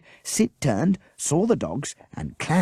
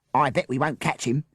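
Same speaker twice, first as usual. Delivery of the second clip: ordinary delivery, nothing marked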